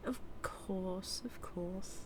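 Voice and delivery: softly, backing off a little; soft concession. Acted. Villain granting the rival time to decide, softly. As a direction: concede it quietly.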